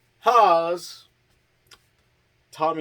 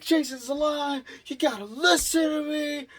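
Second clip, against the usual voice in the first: high put-on frantic voice; mock pleading. Tommy pleading with the sheriff, high mock plea. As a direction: beg him to believe you.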